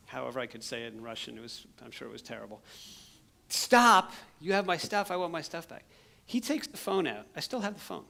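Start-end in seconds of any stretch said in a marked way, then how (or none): 3.5-5.8 high, loud shout fading out by the end; yelling. Retold or imitated. Missionary shouting at the thief to give his stuff back. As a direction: call out stop, then ease off. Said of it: "I'm yelling at him".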